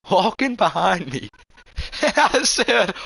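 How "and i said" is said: ordinary talk, nothing marked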